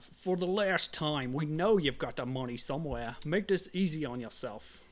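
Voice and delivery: loud, drawn-out tough-guy threat; character voice. Acted. Player voicing a thug demanding money, loud put-on character voice. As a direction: put on a pushy thug's voice, loud and deliberate. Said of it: "what kind of voice does this guy have".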